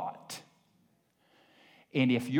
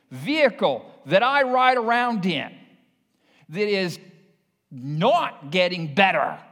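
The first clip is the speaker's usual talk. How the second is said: loud, high, driving preacher's voice; louder and higher, hammering each phrase home; full-force preaching; 7 semitones higher, 4 dB louder than usual. Acted. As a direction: preach it out to the congregation with fire.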